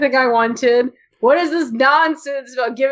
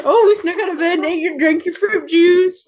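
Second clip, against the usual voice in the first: mock bratty kids; 7 semitones higher than usual. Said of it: "mocking bratty siblings again".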